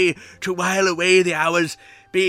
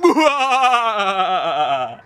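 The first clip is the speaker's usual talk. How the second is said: evil villain cackle. Acted.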